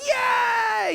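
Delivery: high, loud cheer; cheering kids. Retold or imitated. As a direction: cheer like delighted children. Kids offered ice cream.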